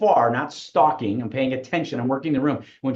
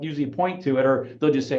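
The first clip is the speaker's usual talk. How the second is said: ordinary talk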